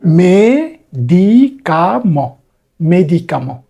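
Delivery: মেডিকামো stretched out very slowly; slow model word. Shown as a demonstration. Teacher slowly modelling the French word for medicine. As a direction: sound out মেডিকামো syllable by syllable.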